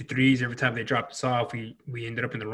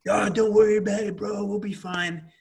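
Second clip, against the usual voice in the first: higher-pitched, brush-it-off voice; acted breezy dismissal; 8 semitones higher than usual. Garrison brushing off an upset Marine, higher-pitched mock reassurance. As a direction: imitate someone waving away a complaint, pitched up.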